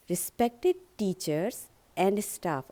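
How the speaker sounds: slow, teachers and staff firmly weighted; slow speech address leaning on teachers and staff; stress on teachers and staff. Shown as a demonstration. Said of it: "आपको teachers and staff पे थोड़ा जोर देना है".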